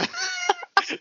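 short burst of high laughter. Real, not acted. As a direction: break into a laugh. Laughing at classmates.

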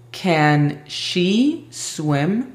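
extremely slow English; slow flashcard prompt. Shown as a demonstration. Teacher quizzing Aimee with flashcards, very slow. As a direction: stretch every word out.

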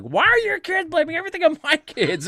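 angry neighbor mom. Retold or imitated. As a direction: play the outraged mother at the door. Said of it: "She's like".

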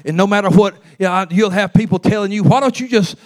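stirring gospel preaching; rising preaching. Acted. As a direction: build the church up again. Preaching build again.

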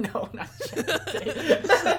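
high squeaky laugh through words. Real, not acted. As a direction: laugh it out slowly. Laughing denial of embarrassing website.

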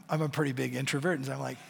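normal talking voice

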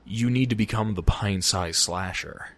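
low, hushed sinister order; creepy game character. Retold or imitated. Game character giving the player a murderous quest, low creepy voice. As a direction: drop the voice low and soft, imitating a creepy villain.

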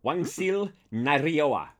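Korean. Shown as a demonstration. Korean line for Paul, rolled R. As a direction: roll the R, slowly. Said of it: "enjoy some Korean".

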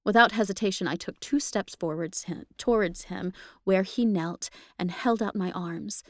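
plain reading voice

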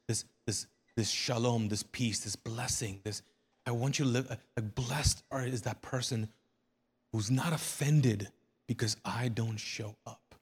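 ordinary talk, nothing marked

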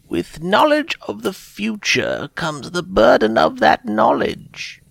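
high and slow, weighty; solemn elder, slow; old holy woman. Acted. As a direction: warn her gravely, slowly. Old seer voiced in story.

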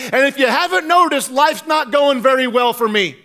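high-pitched, aggrieved protest; Elijah blaming God. Acted. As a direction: argue hard, pitch way up, accusing. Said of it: "Elijah's pretty much blaming God".

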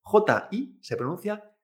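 ordinary talk, nothing marked

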